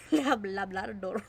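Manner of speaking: Labrador with a Spanish accent, rolled r. Shown as a demonstration. Reader laughing at her own Labrador, Spanish accent. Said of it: "why am I saying it with a Spanish accent".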